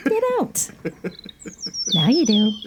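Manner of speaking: high mock-scolding "you don't"; teasing. Real, not acted. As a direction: poke fun, voice jumping up. Teasing listener.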